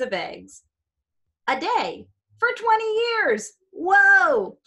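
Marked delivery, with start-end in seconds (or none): 1.5-4.6 high, loud astonished gasp of wonder; amazed whoa. Acted. Teacher amazing the class with a tapeworm fact, loud astonished whoa. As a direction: build up to a big amazed whoa.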